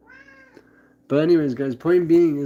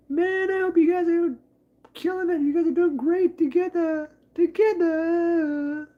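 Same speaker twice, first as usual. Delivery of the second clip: much higher squeaky cheering voice. Acted. Cheering long-married couples on, in a much higher put-on voice. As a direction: cheer the couples on in a tiny high voice.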